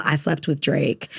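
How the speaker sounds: low, offhand mock confession; drunk friend. Friend telling on her drunk pal, low mock-tipsy confession. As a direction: lightly imitate a tipsy friend's casual boast. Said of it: "when she gets drunk".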